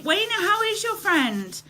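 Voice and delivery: ordinary talk, nothing marked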